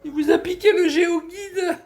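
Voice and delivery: very high put-on caricature voice; hammed-up high-pitched impression with laughter; mocking imitation. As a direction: mimic him in a silly high squeal, laughing. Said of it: "Et il parle comme ça".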